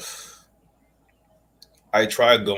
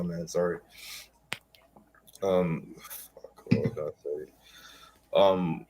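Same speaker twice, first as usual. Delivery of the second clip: ordinary talk, nothing marked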